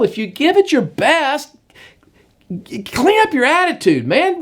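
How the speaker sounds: high, pressing coach voice urging Cain on; rally him, don't let him quit; fired-up exhortation. Acted. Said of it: "It's like".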